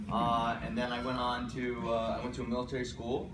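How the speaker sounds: ordinary talk, nothing marked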